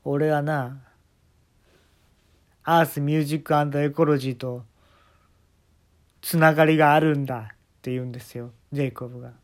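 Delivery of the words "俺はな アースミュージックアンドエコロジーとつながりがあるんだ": boastful fortune teller. Retold or imitated. Jacob's boast.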